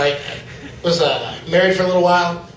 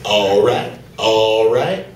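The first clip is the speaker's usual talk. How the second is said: much lower, deep voice drawl; Michael Clarke Duncan impression; 9 semitones lower, much slower than usual. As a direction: go deep and slow like a big gentle giant. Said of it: "he talks like this".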